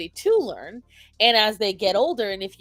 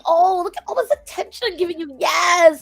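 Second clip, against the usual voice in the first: higher and louder, hammed-up cheering of the dog; mock praise; 5 semitones higher, 7 dB louder than usual. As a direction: gush over the bad behavior, peaking on Yes. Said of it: "You're saying".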